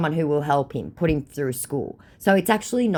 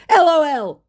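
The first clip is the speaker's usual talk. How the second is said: high, loud spelled-out mock laugh; mock scammer; 14 semitones higher, 9 dB louder than usual. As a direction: parrot the scammer's message up high. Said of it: "To which he goes".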